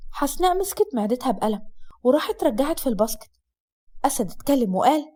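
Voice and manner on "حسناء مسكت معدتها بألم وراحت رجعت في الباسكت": usual speaking voice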